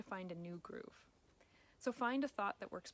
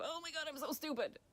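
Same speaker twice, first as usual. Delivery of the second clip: higher, put-on exasperated self-scolding; mocking inner critic; 7 semitones higher than usual. Coach mimics falling back into self-blame: higher mocking whine. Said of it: "fall right back into".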